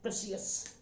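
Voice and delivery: प्रेशियस said softly with an extra "ee" before the last syllable, pre-si-yas; soft प्रेशियस, ending in "si-yas"; common mispronunciation. Retold or imitated. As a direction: quietly show प्रेशियस as three syllables. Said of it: "इसको काफी लोग प्रेशियस पढ़ते हैं".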